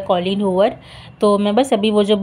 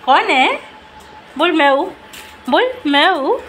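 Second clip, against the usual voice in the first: squeaky praising a dog; 7 semitones higher than usual. Real, not acted.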